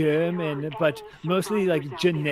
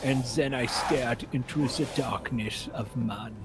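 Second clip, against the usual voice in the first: Werner Herzog impression; 3 dB quieter than usual. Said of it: "my Eurotrash is a little Werner Herzog".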